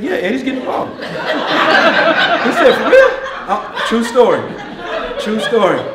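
loud and high, laughing through it; can barely get it out for laughing; laughing storytelling. Real, not acted. Coach cracking up retelling the huddle story.

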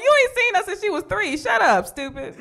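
high, sassy put-down; high mock retort. Mom answering deadbeat dads, high sassy retort. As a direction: clap back high at the deadbeat dad.

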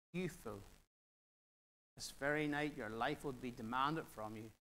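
measured, solemn Bible reading; scripture reading. Acted. Preacher reads Luke to congregation, solemn reading. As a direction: read the passage with weight.

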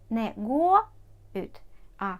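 Не go ut with go stressed and ut dropped; teacher demo of the wrong stress. Shown as a demonstration. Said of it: "Завжди наголос на оці частинки".

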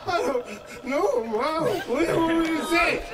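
put-on high, drawn-out whining child; hammed-up tearful protest; wailing kid. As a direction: wail helplessly at mom. Child wailing back at mom.

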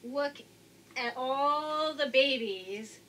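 ordinary delivery

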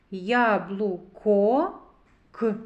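slow "Яблуко", then a lone "К"; sounding out. Shown as a demonstration. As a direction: draw out "Яблуко", pause, isolate the sound "К". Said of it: "зробимо звуковий аналіз слова яблуко".

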